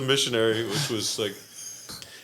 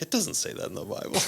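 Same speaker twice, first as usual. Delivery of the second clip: incredulous whisper; 12 semitones higher than usual. Retold or imitated. Incredulous reply to drunk guy.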